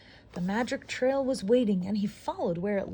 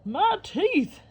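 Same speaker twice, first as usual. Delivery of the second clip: high, loud cry of pain; 10 semitones higher, 5 dB louder than usual. Acted. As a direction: yelp about the sore teeth. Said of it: "the alligator cried".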